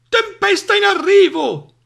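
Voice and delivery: loud, high, slow chorus; all the animals shouting together. A narrator's reading. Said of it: "dissero gli animali in coro". (coro di animali)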